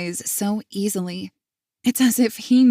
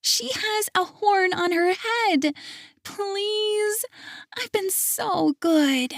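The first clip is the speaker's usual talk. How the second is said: put-on much higher, slow pleading child; whiny little girl; 13 semitones higher, slower than usual. Girl pleading with grandpa for a doll, high and slow. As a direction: drag it out like a begging girl. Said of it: "she says louder".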